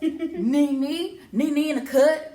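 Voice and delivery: loud, high, gleeful announcing; hyped shout-out. Real, not acted. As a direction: call out the guest like a hype man.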